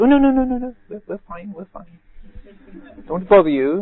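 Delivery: high, flustered lady's voice, the last words fading; flustered woman. Retold or imitated. Trespassing woman brushing off the monk, high and evasive. Woman waving off the monk's offer.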